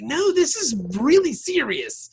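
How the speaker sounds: high, loud, urgent plea. Retold or imitated. Vic voiced in retelling.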